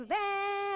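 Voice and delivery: high, held honk; imitated car horn. Driver honking the horn too much, imitated honk. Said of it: "eu buzinei tanto que a buzina".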